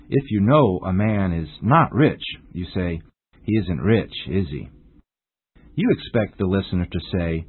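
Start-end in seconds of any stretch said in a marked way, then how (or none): 3.4-4.7 "is he" dropping low at the end; falling tag question. Shown as a demonstration. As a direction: let "is he" fall, expecting agreement. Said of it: "you must use a falling intonation".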